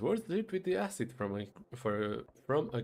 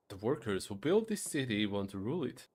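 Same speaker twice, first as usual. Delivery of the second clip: ordinary delivery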